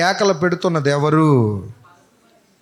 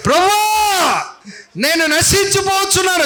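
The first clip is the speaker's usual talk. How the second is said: desperate plea; 13 semitones higher than usual. Shown as a demonstration. Preacher shows the drowning man's cry, wailing. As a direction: wail it out like a drowning man. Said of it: "చెప్పు".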